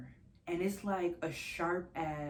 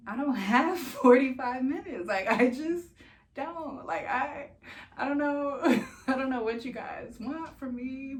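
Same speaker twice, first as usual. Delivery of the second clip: high, loud, half-laughing exasperation; exasperated protest; 9 semitones higher, 8 dB louder than usual. Retold or imitated. Patient told to wait for test results, exasperated. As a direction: plead in disbelief, pitched up and breaking into laughs.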